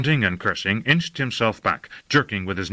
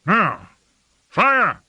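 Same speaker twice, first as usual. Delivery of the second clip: high, loud shout to fire; shouted military command; firing command; 12 semitones higher, 5 dB louder than usual. A narrator's reading. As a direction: give the order at a raised pitch. Lord orders the weapon fired.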